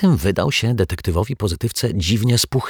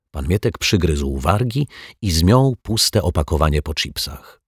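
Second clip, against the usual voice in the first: ordinary delivery, nothing marked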